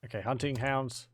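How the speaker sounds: usual speaking voice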